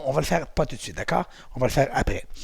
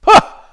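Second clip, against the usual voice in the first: loud, very high scream; thrilled scream; 14 dB louder than usual. Acted. Instructor shrieks for the class at the ride's end, loud and high. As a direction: shriek as the ride ends. Pretend ride, screaming again.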